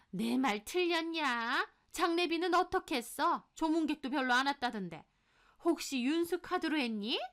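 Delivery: high, accusing questions; haughty mother-in-law needling; prying mother-in-law. Acted. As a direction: interrogate the daughter-in-law suspiciously.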